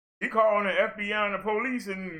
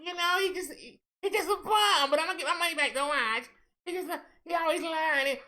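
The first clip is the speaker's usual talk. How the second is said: high, whiny mock voice; mocking the woman; 7 semitones higher than usual. Mocking the woman who complains about her ex, high whiny voice. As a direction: whine it out like her.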